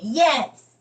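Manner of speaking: put-on high, loud little-kid yes; a pouting kid insisting; child's answer. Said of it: "You sad at me?".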